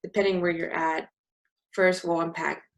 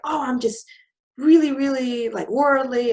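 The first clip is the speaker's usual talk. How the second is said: high, loud mock boasting; mocking worldly landlady; 8 semitones higher, 7 dB louder than usual. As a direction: ham up her self-praise.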